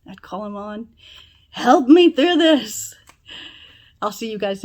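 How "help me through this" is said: loud, high, drawn-out plea; calling out. Acted. Showing how he'd call on God, loud plea. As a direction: cry out to God for help, slowly.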